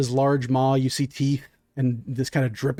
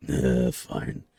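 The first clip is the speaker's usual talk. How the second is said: sulky deep creature; 4 dB quieter than usual. Acted. Creature sulks.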